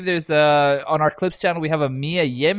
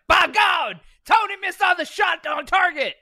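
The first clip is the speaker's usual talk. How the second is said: J R commentary. Retold or imitated. Co-host reading a fan story, shouting as J R on commentary. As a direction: yell it like an excited ring announcer. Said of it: "J R on comms is like".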